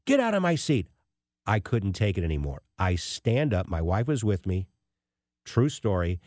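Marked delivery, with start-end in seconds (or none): 0.1-0.9 retold yelling. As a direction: imitate a man yelling at a stranger, voice pitched high. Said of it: "he starts yelling at this guy".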